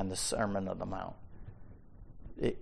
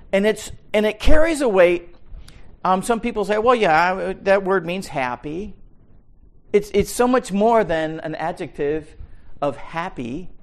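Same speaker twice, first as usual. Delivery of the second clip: much higher and louder pulpit voice; full-force preaching; 7 semitones higher, 7 dB louder than usual. Acted. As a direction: drive the point hard.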